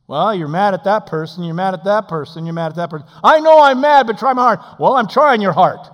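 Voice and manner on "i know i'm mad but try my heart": loud, high pleading cry; angry man crying out to God; pleading prayer. Acted. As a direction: beg the Lord loudly.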